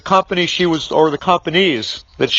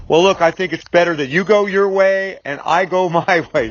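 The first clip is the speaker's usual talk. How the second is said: ordinary talk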